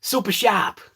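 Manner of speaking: "Super sharp" as Boston supa shap; Boston accent. Shown as a demonstration. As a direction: drop both R's in "Super sharp", Bostonian style. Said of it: "S U P A S H A P".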